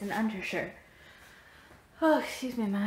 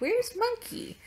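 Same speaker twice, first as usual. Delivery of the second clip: alarmed question; 14 semitones higher than usual. Retold or imitated. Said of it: "And I'm like".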